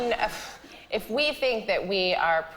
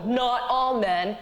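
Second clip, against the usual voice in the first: mocking voice; much slower than usual. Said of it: "men watching this right now who go".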